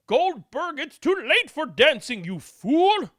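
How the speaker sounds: high loud scolding; scolding mom. Acted. Mom rejects the waltz, high loud scold. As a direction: snap at him for the waltz.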